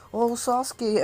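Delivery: high, swooning mock fangirl; lovestruck fangirl. Acting lovestruck Sakura fawning over Sasuke.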